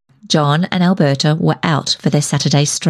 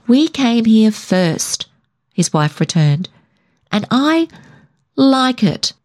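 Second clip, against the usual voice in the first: slow, higher, firm stress on I; stubborn insistence; 5 semitones higher, slower than usual. A narrator's reading. Alberta refuses to give up the house, stubborn and higher. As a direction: dig in her heels.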